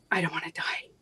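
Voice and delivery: unvoiced whisper, barely audible; whispered plea. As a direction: breathe the words out without voice. Retelling her words after the pills.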